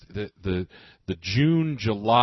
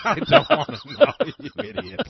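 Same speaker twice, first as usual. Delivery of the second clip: high laughing protest; 13 semitones higher, 7 dB louder than usual. Real, not acted. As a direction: laugh it out, amused. Laughing at partner's joke.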